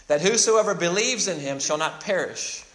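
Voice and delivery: ordinary talk, nothing marked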